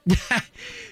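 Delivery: high burst of laughter. Real, not acted. Burst at caller's confusion.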